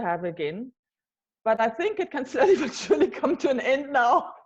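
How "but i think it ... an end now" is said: laughing through the words, voice up and louder; amused, laughing while talking. Real, not acted. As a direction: chuckle as you say it. Laughing about lockdown ending.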